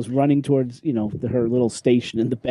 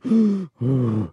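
imitated hyperventilating. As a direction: puff hard as if breathing into a bag. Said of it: "Lady starts hyperventilating into the bag".

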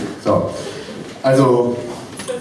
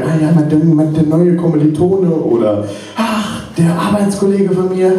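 higher-pitched imitation of a chatty friend; gushing female friend; 8 semitones higher than usual. Friend phoning with news of men, imitated gushing. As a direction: mimic a friend gushing about a new crush. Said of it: "eine sehr gute Freundin, die mich immer wieder anruft".

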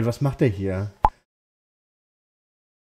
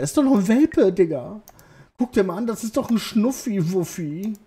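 cooing over puppy; 7 semitones higher than usual. Real, not acted.

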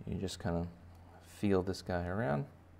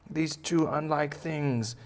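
high, slow-stretched gloating bunny voice; boastful baby bunny; 9 semitones higher than usual. Acted. Bunny boasting of being invincible, high drawn-out gloat. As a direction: gloat high, drawing out key words. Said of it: "And he's like".

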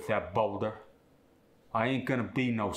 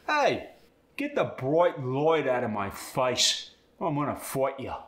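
light said as "loit", bright and fight with an OI vowel; Cockney threat, OI in light and fight; Cockney oi sound; 3 semitones higher than usual. Shown as a demonstration. Student demos the Cockney I sound on the bright light line. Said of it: "gets turned into the oi sound".

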